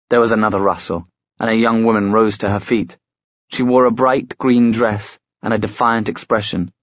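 ordinary delivery

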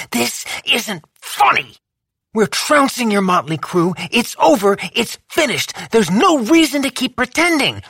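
high, strained insistence; urgent plea mid-fight. A narrator's reading. Lou to Boz, high, urgent.

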